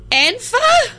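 squeaky high call of his name; [name] trying to get Banfor's attention. Acted.

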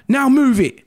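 high, loud commanding shout; barked order. Retold or imitated. Physio ordering the patient into the car, shouting. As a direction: order him to move, no arguing. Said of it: "She's shouting".

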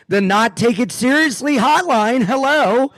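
high, bright mock phone greeting, laughing into it; hotline operator. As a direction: answer the banana like a goofy hotline. Pretend hotline phone answer.

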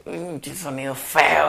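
slow, sour "qué sonido feo"; mock disgust. Rapper mocking bad venue sound, slow disgust. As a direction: drag it out, unimpressed. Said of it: "llegar a un lugar y".

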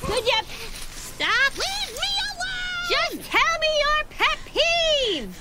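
high, slow pleading; pleading whine. Acted. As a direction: beg in a drawn-out whine. Dog begs chased squirrel.

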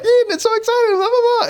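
very high-pitched mock-gushing squeal; hammed-up hype aimed at a small child; over-the-top excited. Dad back down distracting his daughter, frantically excited. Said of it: "it's just in her face".

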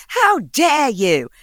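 drawn-out indignant "How dare you", pitched high; mock outraged girlfriend. Girlfriend snapping back at her boyfriend: slow, high mock outrage. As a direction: huff it out slowly, appalled. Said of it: "She's like".

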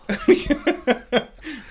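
a sudden high-pitched burst of laughter; high squealing laugh. Real, not acted. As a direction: let a squeal of laughter break out. Group jokes about waddling.

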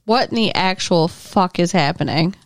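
ordinary talk

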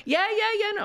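very high mock agreement; high-pitched retold reassurance; Davina Divine. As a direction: imitate an eager queen agreeing. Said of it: "She's like".

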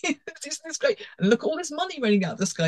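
high-pitched, hammed-up glee; mock over-cheery. Bank gushing to customers about money, mock over-cheery. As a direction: gush like a wildly inappropriate bank email.

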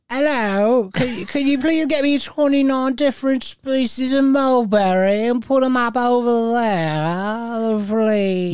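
high, slow, mock-regal request; posh Queen voice. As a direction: play a prim queen asking politely. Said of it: "I like how she personally requested".

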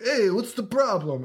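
exaggerated high movie-character take; mock Marlon Brando impression. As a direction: mimic an actor's odd high voice. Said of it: "His voice changes every fucking scene".

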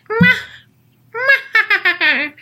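high, loud cartoon-witch kisses; Disney witch. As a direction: smack it like a gleeful witch. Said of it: "sounds like a Disney witch".

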